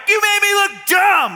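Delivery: high, loud, hammed-up angry yell; real anger. Balaam yelling back at his donkey, acted furious shout. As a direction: blow up in mock fury. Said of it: "Like, that's real anger".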